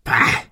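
short, unpitched grunt of disgust; spitting scoff. A narrator's reading. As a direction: spit out a contemptuous bah. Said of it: "Er spuckte aus".

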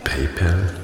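"PayPal" tried with a short "-pel" ending; trying pronunciations. Shown as a demonstration. Call-taker unsure how the name is pronounced, trying "PayPal" as "pay-pell". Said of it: "wie man es richtig ausspricht".

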